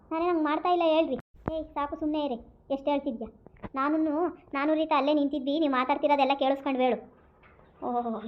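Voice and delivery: normal talking voice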